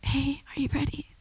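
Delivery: soft, whispered invitation; still small voice. Retold or imitated. God quietly calling the speaker, still small whisper. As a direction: whisper it gently, barely voiced. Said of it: "just that still small voice".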